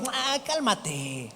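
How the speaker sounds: badly faked calm with a drawn-out "Ah"; mock panicky calming. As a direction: wail it as if just as spooked. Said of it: "Yo no creo que Jesús les haya dicho".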